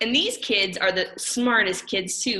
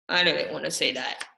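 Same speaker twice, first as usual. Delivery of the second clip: grumbling; 5 semitones lower than usual. Acted. Grumbling about forced word.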